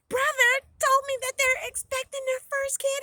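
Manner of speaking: high, loud, happy news-breaking; excited announcement. A narrator's reading. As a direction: burst out with the family news. Heroine announces baby news to family.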